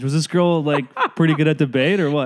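ordinary talk

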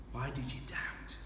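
whispered, gentle rebuke; whispered question. Acted. Jesus to Peter in the storm, whispered gentle rebuke. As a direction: breathe it like a tender question.

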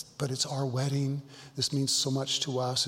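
ordinary talk

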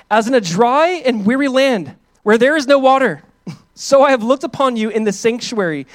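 slow, rising, fervent psalm reading; impassioned scripture reading. Acted. Preacher reading Psalm 63 to the congregation, slow and impassioned. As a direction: read it like a cry of longing.

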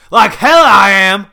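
very loud, high, stretched-out refusal; defiant shout. A narrator's reading. Grandfather refusing to move out, shouting at his son. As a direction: shout it back in outright defiance. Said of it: "Grandpa shouted".

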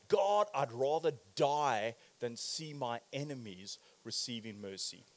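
low, soft, sinking voice; bitter plea to God. Acted. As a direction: let it drop away into a dark murmur.

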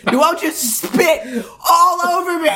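high-pitched laughing protest. Real, not acted. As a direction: squeal it through laughter. Laughing after being spat on.

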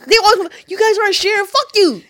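loud, high, heated yelling; real angry outburst. Real, not acted. Ranting to co-host about always having to share, loud anger. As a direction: let real fury out. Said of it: "I'm so angry right now".

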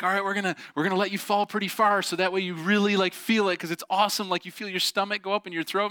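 high voice imitating eager sisters; persuasive sisters. Sisters talking their brother into falling far, persuasive. Sisters selling the trust fall.

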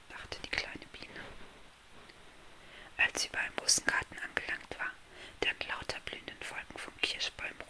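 soft unvoiced bedtime-story whisper; hushed fairy-tale narration; whispered storytelling. A narrator's reading. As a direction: whisper the tale close to the listener.

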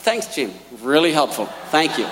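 sarcastic thanks. Retold or imitated. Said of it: "I said".

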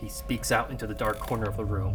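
ordinary talk, nothing marked

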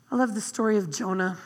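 usual speaking voice

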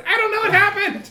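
a comic wounded intern freaking out; panicked high squeal. Acted. GM voices hurt Gerby.

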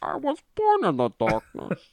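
lightly voiced movie villain; a hint of Bane's line. Quoting Bane in the movie.

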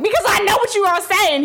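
high-pitched, exasperated comeback; insistent retort. Acted. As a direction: insist in mock indignation. Acted quarrel with boyfriend.

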